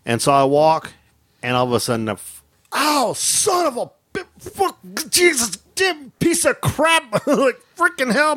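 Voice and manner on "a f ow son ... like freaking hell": high, slow pained cursing as if just stung; reliving a bee sting, high and slow; pained yelping. Retold or imitated. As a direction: yelp and curse through the sting. Reenacting being stung by a bee.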